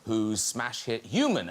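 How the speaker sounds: normal talking voice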